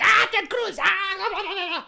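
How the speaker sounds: sneering parody of a politician brushing off Ted Cruz; mocking AOC. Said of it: "A O C going like".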